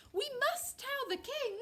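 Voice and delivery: high-pitched chick voice; squeaky alarm; urgent chick. Acted. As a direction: plead urgently as a little bird.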